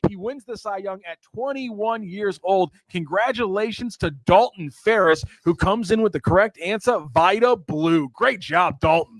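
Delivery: high-pitched, excited winner announcement; game-show announcer. Real, not acted. Host revealing the trivia winner and answer, in high announcer voice.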